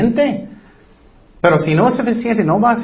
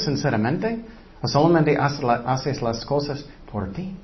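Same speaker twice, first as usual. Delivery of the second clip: ordinary delivery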